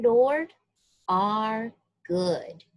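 ordinary delivery, nothing marked

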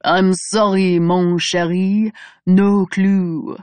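mock a French woman's accent, teasing. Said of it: "Michael says in a mocking French accent".

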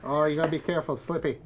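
East Coast Canadian warning; Canadian East Coast accent. Shown as a demonstration. Showing host a real East Coast accent, Canadian Maritime warning. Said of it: "do a real Canadian East Coast accent".

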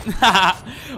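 loud gleeful laugh. Real, not acted. Laughs after sniping enemy.

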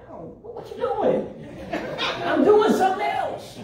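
high, breathy, laughing brush-off; mock dismissive husband. Husband laughing off his wife's goodbye kiss, mock dismissive. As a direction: wave her off like a busy husband.

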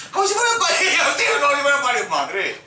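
astonished outburst. Real, not acted. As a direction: repeat it in a rising cry of amazement. Repite asombrado, gritando. (grito agudo de asombro)

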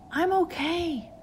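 put-on high, slow child voice; singsong lost child; eerie child's voice. A narrator's reading. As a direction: draw it out high like a ghostly little girl. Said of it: "the voice responded".

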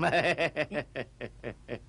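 a deep, hearty chuckle; low hearty laugh. Acted. Old man laughing at family.

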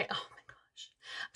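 whispered oh my gosh; excited whisper. Real, not acted. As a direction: breathe it out in a whisper. Sudden hushed excitement.

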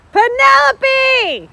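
long, high call across the course. Real, not acted. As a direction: call her name from far away. Mother calling child across course.

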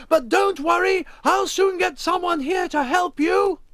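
high, loud calling voice; shouted reassurance. Acted. Wally promises Emma help, shouted reassurance. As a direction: call out loudly to someone in the water.

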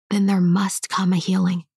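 usual speaking voice

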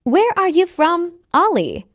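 usual speaking voice

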